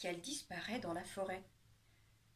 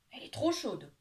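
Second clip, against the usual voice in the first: put-on old man crying out; 7 dB louder than usual. Old man cries out in story.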